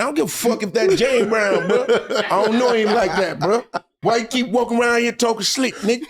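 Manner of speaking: laughing defiant retort. Retold or imitated. Retorting young player, laughing.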